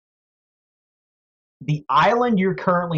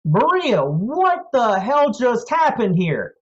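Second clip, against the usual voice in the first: high, loud and slow panicked question; a frantic little mouse demanding answers; alarmed mouse folk; 8 semitones higher, 3 dB louder than usual. Acted. Mouse folk runs to Maria, high panicked shout. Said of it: "He runs out and says".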